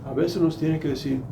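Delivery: normal talking voice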